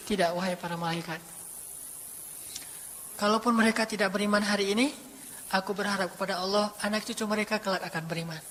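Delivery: quiet and low, gently refusing; soft forgiving reply. Acted. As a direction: speak softly, full of mercy. Prophet answers softly, forgiving.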